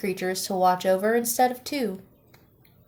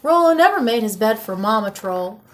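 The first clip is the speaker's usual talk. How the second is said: usual speaking voice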